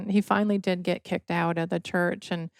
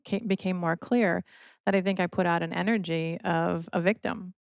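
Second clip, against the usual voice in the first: usual speaking voice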